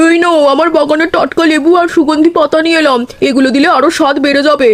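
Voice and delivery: put-on a cheerful older neighbour dropping by; aunt's voice. Aunt brings lemons and leaves.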